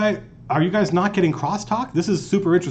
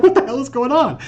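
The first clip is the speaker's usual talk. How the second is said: high-pitched, baffled question; mock confusion in a high voice; imitated bewilderment; 10 semitones higher, 4 dB louder than usual. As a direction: act out someone utterly baffled. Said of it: "he looks around like".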